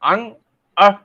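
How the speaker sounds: अंग with a ringing nasal ng, अह with a breathy h; nasal sounds demo. Shown as a demonstration. Teacher demonstrating अंग and अह to the camera. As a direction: show the nose moving on अंग. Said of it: "आप देखिए, मैंने बोला".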